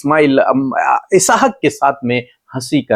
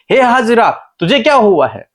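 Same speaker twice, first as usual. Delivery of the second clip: higher-pitched, concerned angel calling out; 9 semitones higher, 3 dB louder than usual. Retold or imitated. Angel calls to the weeping Hagar, raised concerned voice. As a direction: ask it as the heavenly messenger, pitched well up. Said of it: "उसके बावजूद परमेश्वर से कहते हैं".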